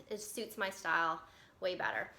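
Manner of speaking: ordinary talk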